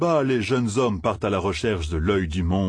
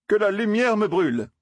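juron très aigu, sans crier fort; lâche le juron d'une voix haut perchée; cri d'exclamation; 14 semitones higher than usual. A narrator's reading. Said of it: "s'écria Matt". (exclamation stupéfaite, très aiguë)

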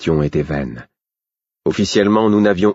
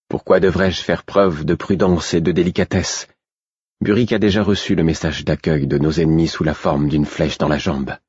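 usual speaking voice

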